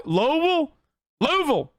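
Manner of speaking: Louisville as LOO-vul, pitched high; Louisville said like a local, short and swallowed. Retold or imitated. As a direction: try out the Kentucky Louisville. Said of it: "They don't say Louisville".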